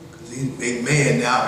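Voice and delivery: ordinary delivery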